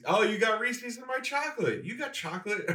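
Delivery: high, bright mock ad exclamation; Reese's ad voice. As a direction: squeal it like the old commercial. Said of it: "it's like the Reese's".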